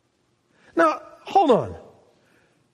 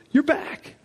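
tender you're back; 7 semitones higher than usual. Retold or imitated. Father holding his son close, tender. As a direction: hush it into his ear.

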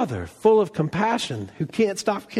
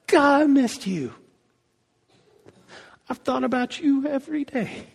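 lightly voiced relative; 6 semitones higher than usual. Relative greeting at airport.

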